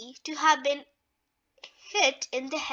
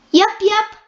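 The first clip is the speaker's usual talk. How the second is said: high, loud giggly yup; 6 semitones higher, 11 dB louder than usual. A narrator's reading. Gus cheering the party on, giggling high and loud. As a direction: bubble over with giggles. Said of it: "giggled Gus".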